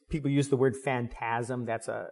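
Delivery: ordinary talk, nothing marked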